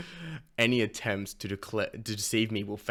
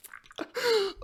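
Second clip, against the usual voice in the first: breathy laugh; 5 dB louder than usual. Real, not acted. Excited reader laughing at the chapter.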